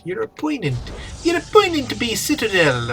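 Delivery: "opponent" drawled high in a hammed-up pirate accent, "oppo-NENT" with a hard t. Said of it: "how you pronounce the word opponent versus opponent".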